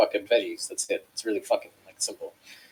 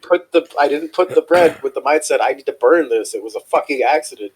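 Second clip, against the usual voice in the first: louder, heated self-defence; retold exasperation; 4 semitones higher, 7 dB louder than usual. Cook defending a burnt pita to the boss, retold exasperation. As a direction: replay your indignant protest.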